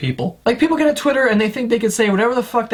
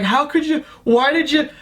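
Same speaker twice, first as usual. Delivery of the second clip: mock outraged fans; 6 semitones higher than usual. Mocking flipping-out fans.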